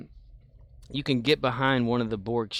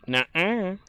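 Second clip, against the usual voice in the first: mocking enemy voice; 7 semitones higher than usual. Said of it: "To say".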